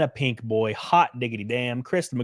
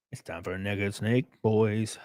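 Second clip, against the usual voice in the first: low murmur; 5 semitones lower, 6 dB quieter than usual. Acted. Repeated low and soft.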